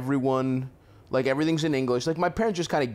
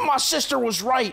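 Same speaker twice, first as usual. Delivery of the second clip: nagging mom; 10 semitones higher than usual. Pretend. Voicing the complaining mom.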